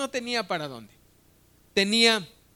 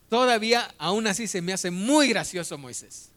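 ordinary delivery

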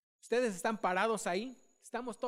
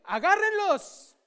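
commanding order; 9 semitones higher, 8 dB louder, slower than usual. Retold or imitated. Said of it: "Elías les dice".

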